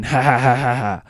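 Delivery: mock gloating laugh. Trevor gloating at Ryan, fake triumphant laugh. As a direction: cackle like you caught him.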